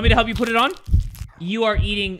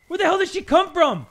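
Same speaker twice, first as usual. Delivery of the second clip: usual speaking voice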